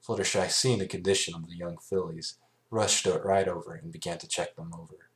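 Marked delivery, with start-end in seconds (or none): none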